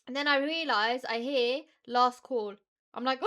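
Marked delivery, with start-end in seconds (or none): 1.9-2.5 loud mock airport call; loud boarding call; announcer call. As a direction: call it out like the tannoy. Airport announcement heard, panic.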